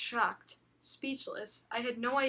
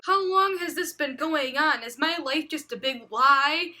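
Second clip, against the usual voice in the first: mock dismay; 4 semitones higher, 7 dB louder than usual. Shocked inner outburst read aloud.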